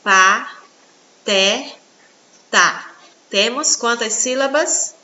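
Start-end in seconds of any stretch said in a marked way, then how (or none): 0.0-1.5 "Pateta" as pa... te... ta, long pauses between; syllable by syllable. Shown as a demonstration. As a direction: break "Pateta" into three slow, separate beats. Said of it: "Vamos separar?". ("Pateta" bem separado: pa, te, ta)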